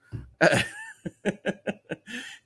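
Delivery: high, breathy laugh at his wife's joke; a bright amused laugh that rises; real laugh. Real, not acted. Laughing at what his wife said, a real rising laugh.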